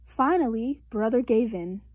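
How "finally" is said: usual speaking voice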